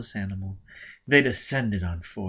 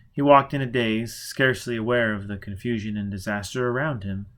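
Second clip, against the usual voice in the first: ordinary delivery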